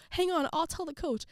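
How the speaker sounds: high, urgent friend voice; Cheryl. Retold or imitated. Teammate going to get the coach, helpful. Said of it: "She's like".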